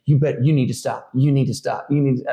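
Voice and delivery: firm retold warning, mildly urgent; insistent, repeated telling-off, lightly acted; not joking. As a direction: imitate a wife insisting, in earnest. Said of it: "she's not joking".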